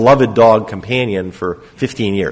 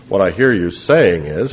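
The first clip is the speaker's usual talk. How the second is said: mock the clichéd shrink with a chin-stroking drone; a hint of a sitcom psychotherapist; parody therapist; 5 semitones lower than usual. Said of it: "the Saturday Night Live version of psychotherapy".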